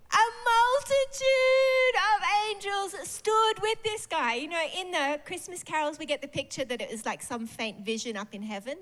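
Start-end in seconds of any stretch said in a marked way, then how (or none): 0.1-4.3 awed exclamation. Real, not acted. Preacher building to angel climax.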